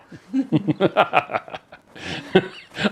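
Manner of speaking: hammed-up mad scientist cackle; evil scientist laugh. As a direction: cackle like a cartoon villain. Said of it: "I should laugh like an evil scientist".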